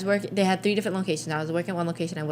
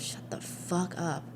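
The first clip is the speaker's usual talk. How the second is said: muttered curse; 9 dB quieter, slower than usual. Retold or imitated. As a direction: mutter it at her back, barely audible. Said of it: "he goes".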